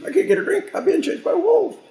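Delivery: very high, squeaky, frantic little deer; panicked deer voice. Retold or imitated. A chased deer refusing to stop for water, squeaky high panic. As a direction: squeal it like a hunted deer who can't stop.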